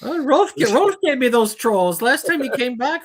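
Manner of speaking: badly faked Scandinavian-Minnesotan accent; hideous fake accent. Blaming Rolf for the trolls, hideous fake accent. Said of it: "Our accents are really pretty hideous".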